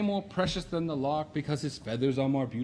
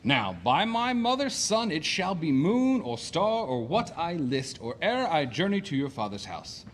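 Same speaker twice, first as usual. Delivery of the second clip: usual speaking voice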